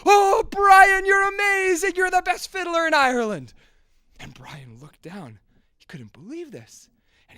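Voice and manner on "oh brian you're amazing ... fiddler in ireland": loud, high crowd cheering. A narrator's reading. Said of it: "burst into cheers and said".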